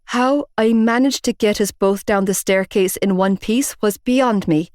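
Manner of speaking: plain reading voice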